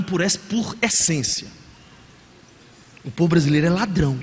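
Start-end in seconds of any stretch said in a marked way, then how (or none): 3.0-4.2 lower, quicker, put-on voice on "O povo brasileiro é ladrão"; mocking repeat. Shown as a demonstration. Preacher mocks the cliché to the congregation, lower and scornful. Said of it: "que o povo brasileiro é ladrão".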